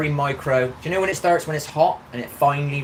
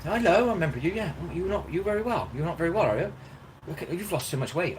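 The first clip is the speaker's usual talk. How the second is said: homeless woman; 7 semitones higher than usual. Retold or imitated. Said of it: "And she said".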